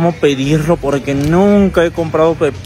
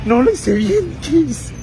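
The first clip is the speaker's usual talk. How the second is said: angustia; 12 semitones higher, slower than usual. Acted. Cree que lo hizo mal.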